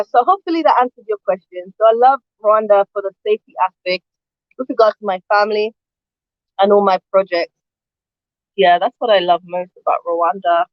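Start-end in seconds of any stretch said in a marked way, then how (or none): none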